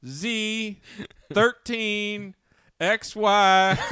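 high, loud put-on caller reading out a model number; prank caller voice. Prank caller spelling a model number, loud goofy voice. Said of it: "he's like".

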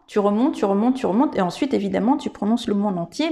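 usual speaking voice

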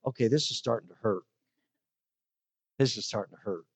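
wounded murmur. Retold or imitated. Reacting to hurtful words on the phone.

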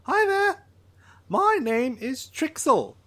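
high, eager drawl voice, thrilled to meet the pilot; excited alien Trixel. Acted. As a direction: greet him like an overeager little alien. Drawl introduces itself.

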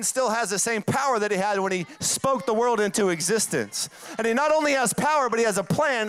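rally the congregation, preacher style; preaching at full force. Acted.

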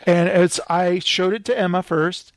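normal talking voice

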